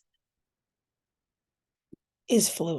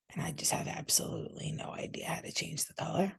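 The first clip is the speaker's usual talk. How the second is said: ordinary talk, nothing marked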